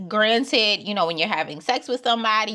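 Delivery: ordinary talk, nothing marked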